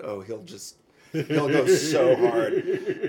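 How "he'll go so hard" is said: slow, louder, laughing through the words; amused laughter while picturing it. Real, not acted. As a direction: laugh it out slowly. Laughing at the thought.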